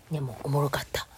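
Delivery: secret whisper. Real, not acted. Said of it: "言うたらあかんし".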